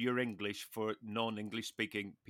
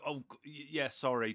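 a light mock Hugh Grant, much softer and flustered; Hugh Grant impression; 7 dB quieter than usual. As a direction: stammer an apology like a mildly voiced Hugh Grant. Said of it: "terribly Hugh Granty".

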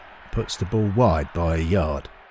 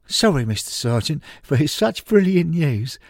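overjoyed; 10 semitones higher, 3 dB louder than usual. Acted. Stan overjoyed at the news.

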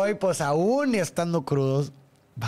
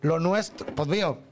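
ordinary delivery

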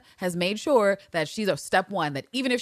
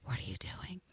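unvoiced whisper, much softer; breathy whisper; 5 semitones lower, 15 dB quieter than usual. Villanelle asking Eve in the bug scene, hushed whisper. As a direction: whisper it low and intimate.

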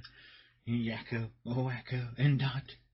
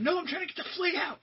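high squeaky voice; faster than usual. Retold or imitated. Acted reply high.

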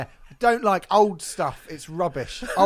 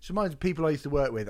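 normal talking voice

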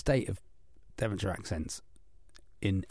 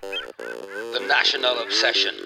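deep slow announcer; 6 semitones lower, slower than usual. Acted. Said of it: "Trying to wake myself".

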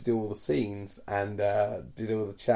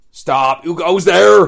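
loud, higher sentry shout; guard's challenge; 7 semitones higher, 17 dB louder, much slower than usual. Pretend. Farmer Vader shouting at rebels breaking in, loud challenge. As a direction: bellow it like a nervous guard.

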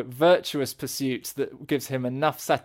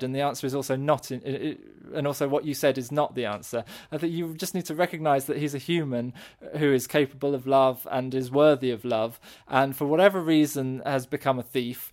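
usual speaking voice

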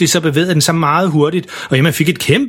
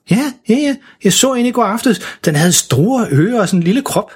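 eager; 8 semitones higher than usual. Acted. Emma eagerly recalls the monster.

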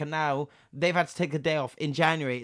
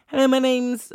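higher, louder sing-song phone greeting; call-centre voice; 9 semitones higher, 7 dB louder than usual. Retold or imitated. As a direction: chirp it like a scripted operator. Mimicking second operator's greeting.

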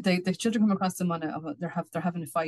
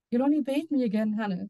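little man explaining; 5 semitones higher than usual. Acted. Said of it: "the little man explained".

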